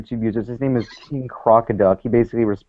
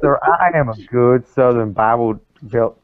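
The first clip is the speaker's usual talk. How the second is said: put-on Southern accent. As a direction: declare it like a Bible-belt Southerner. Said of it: "You should work on your accent".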